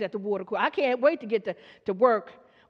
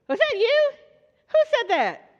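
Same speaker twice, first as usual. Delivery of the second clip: high, louder surprised delight; 8 semitones higher, 4 dB louder than usual. Real, not acted. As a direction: caught off guard, calling out to the crowd. Reacting to deep voice in congregation.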